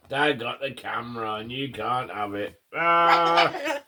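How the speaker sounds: ordinary delivery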